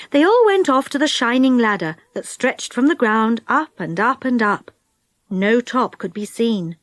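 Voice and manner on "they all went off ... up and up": plain reading voice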